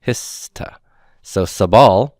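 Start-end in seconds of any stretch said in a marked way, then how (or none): 0.0-0.8 hista whispered, no voice; whispered repeat. Shown as a demonstration. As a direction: breathe out hista softly as a demo. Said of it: "it becomes hista".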